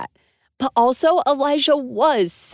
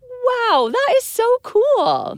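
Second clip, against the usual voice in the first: amazed; 13 semitones higher, 5 dB louder, slower than usual. Real, not acted. Amazed reaction.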